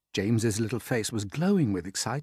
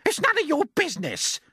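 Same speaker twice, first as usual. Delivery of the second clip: a far higher, louder aunt's shout; Aunt Spiker shouting; 6 dB louder than usual. Acted. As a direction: screech it out as the nasty aunt. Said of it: "Aunt Spiker snapped".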